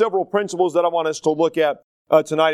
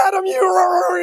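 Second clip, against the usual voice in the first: much higher, crying half-shout of a panicked father; frantic excited dad; 14 semitones higher, 5 dB louder than usual. Retold or imitated. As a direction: play the dad fussing wildly over his son. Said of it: "he's kind of an excitable person".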